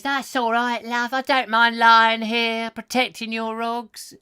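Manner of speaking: high, drawn-out comic woman's voice; put-on high voice, slow and loud in the middle; voiced tarpaulin character. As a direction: play Pauline lying over the logs, high and slow. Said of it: "and she says".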